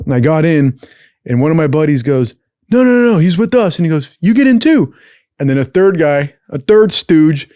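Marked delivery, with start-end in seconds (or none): 2.7-3.8 high-pitched imitation of a buddy butting in; urgent protest. Buddy pleads with cop for his friend, mock urgent protest. 4.2-4.9 high, loud imitation of a cop; cop's order. Cop orders the protesting buddy in, loud mock command.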